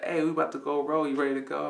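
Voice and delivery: cheerful uncle hurrying him out; upbeat uncle. Retold or imitated. Uncle voiced, upbeat invite.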